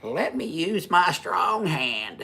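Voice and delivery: high, slow, hammed-up movie line; Scary Movie quote. Joking to his wife, a slow high mock movie line. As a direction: stretch it out high like the spoof film. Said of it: "have you seen, is it Scary Movie?".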